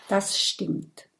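plain reading voice

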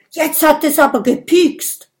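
high, loud, rapid protest; agitated complaint. Acted. Dragon insisting the needle pricked, agitated. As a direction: blurt it out in alarm.

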